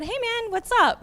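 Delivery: retold high friendly hello; friendly greeting. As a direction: greet him high and upbeat. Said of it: "I said".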